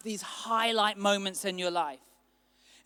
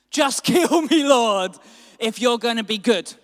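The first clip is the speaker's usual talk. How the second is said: furious outburst; 2 semitones higher, 9 dB louder than usual. Acted. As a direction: shout at God, half laughing in frustration. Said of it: "he got angry".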